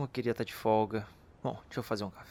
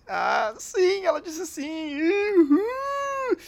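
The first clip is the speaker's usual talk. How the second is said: overjoyed; 6 dB louder, much slower than usual. Acted. Clara said yes.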